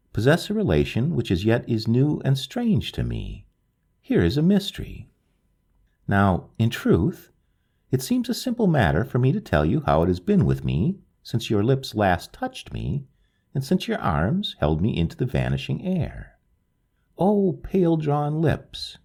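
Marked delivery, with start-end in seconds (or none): none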